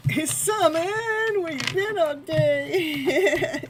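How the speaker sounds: high, slow, drawn-out call to a friend; teasing laughing hello; sing-song greeting. Real, not acted. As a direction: sing it out to someone arriving late. Greeting a late viewer.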